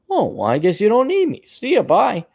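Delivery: high sarcastic brush-off; dad dismisses the son with a breezy goodbye; mock dismissive. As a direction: wave them off breezily. Mock parents' dismissive farewell.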